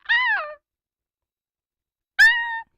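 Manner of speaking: high loud scream. Real, not acted. Screaming during game.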